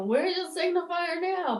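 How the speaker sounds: high-pitched mock questioning; acted waitress's question. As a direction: lift the voice high, playing the waitress. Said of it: "she's like".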